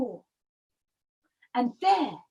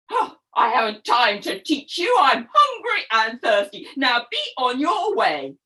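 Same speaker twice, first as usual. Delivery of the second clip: louder, lower, impatient goose; brusque mother goose; 3 semitones lower, 5 dB louder than usual. Acted. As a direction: shoo him away, no time. Busy Mother Goose brushes him off.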